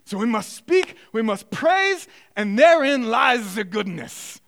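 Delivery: put-on German-accented lecturer urging the class on. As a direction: build to fervent praise in a German accent. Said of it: "in German accent, I'm gonna do my best".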